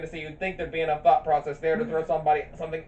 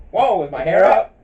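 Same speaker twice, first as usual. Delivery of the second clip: laughing quote; 5 semitones higher, 12 dB louder than usual. Retold or imitated. Quoting Pegasus, laughing.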